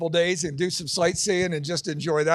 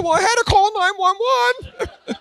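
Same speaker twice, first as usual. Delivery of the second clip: much higher, slow, laughing; ditzy blonde; 12 semitones higher, 4 dB louder, slower than usual. Acted. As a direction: play the airhead's proud answer. Blonde's punchline answer.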